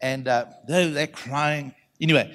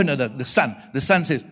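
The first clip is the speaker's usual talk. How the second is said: usual speaking voice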